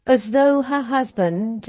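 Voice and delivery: ordinary delivery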